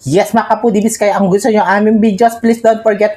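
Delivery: loud, high outro pitch; rally the viewers to subscribe; excited vlogger. Acted. Vlog outro call to viewers.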